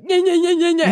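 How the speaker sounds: high, loud rapid yeahs; Homer's repeated yeah. Retold or imitated. Retelling Homer repeating yeah compulsively, loud and high. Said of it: "And so he just goes".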